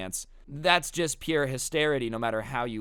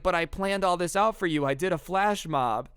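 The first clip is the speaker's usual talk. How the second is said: higher, pleading imitation; mock wounded protest; 6 semitones higher, 3 dB louder than usual. Rejected Chad protests to Alice about his flash mob, mock wounded whine. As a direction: plead as if all that effort was wasted.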